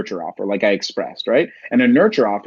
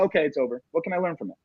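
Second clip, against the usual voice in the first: normal talking voice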